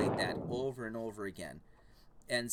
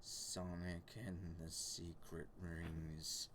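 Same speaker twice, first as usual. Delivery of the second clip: ominous title; 6 semitones lower, 8 dB louder, much slower than usual. Pretend.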